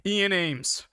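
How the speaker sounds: high, loud, urgent call; shouting a name. A narrator's reading. Narrator slamming the hospital counter, shouting out a boyfriend's name. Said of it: "when I shout out my boyfriend's".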